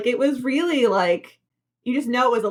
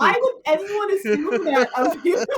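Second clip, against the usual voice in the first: laughing mock reply; 6 semitones higher than usual. Mocking the others' reply.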